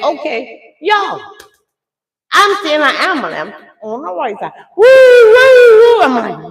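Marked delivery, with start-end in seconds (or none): 4.8-6.0 high, loud wailing siren noise; drawn-out siren imitation, pitched way up; imitated siren. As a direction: woo like an ambulance siren. Imitates passing ambulance siren.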